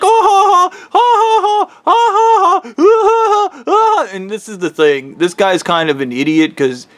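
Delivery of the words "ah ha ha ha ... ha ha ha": high, loud mock laughter. Friend laughing at him while they wrestle, high loud mock laugh. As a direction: cackle at him in a high taunting voice. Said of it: "he's like".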